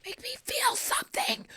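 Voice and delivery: intense, breath-only whisper; whispered plea. Pretend. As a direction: beg in a dramatic whisper. Mock plea to the masseuse.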